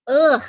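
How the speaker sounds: sharp burst of real disgust; disgusted "ugh". Real, not acted. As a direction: let out a loud, exasperated ugh. Disgusted reaction to the impression.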